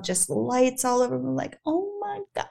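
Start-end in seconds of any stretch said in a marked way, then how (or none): 1.7-2.5 high, soft gasp of wonder; imitated soft, high amazement; hushed awe. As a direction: breathe it like an awestruck fan. Retelling crowd awe at lights.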